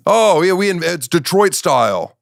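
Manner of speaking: lightly mock a proud Detroit pizza fan; mocking Detroiter. Comic mocking Detroit pizza pride, high smug voice. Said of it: "You didn't come up with rectangles".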